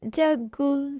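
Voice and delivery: tearful call of his name; acted weepy voice; crying. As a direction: say it through sobs. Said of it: "क्यों रो रही हो".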